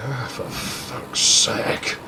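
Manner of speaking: slow, voiceless sigh of the words; exasperated sigh. Retold or imitated. Man worn down by her refusals, slow exasperated sigh. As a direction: breathe out the curse in retold exasperation.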